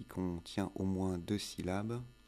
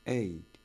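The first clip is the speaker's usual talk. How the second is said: "Eighty" stressed on the first syllable, EIGH-ty, loud; word stress; 6 dB louder than usual. Shown as a demonstration. Teacher contrasting with eighteen, EIGH-ty stressed on first syllable. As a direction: hit EIGH hard in "Eighty". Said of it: "l'accent tonique en anglais".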